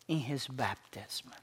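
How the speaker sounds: whisper. Real, not acted. Sermon drops to a whisper.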